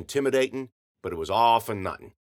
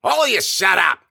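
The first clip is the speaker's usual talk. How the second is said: loud, high angry yell; 9 semitones higher, 10 dB louder than usual. A narrator's reading. O'Neill silences the brawlers, yelling. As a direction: bellow over all of them. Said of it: "O'Neill yelled".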